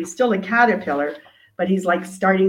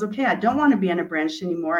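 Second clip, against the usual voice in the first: caterpillar's quick resolve; faster than usual. Acted. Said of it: "but he goes".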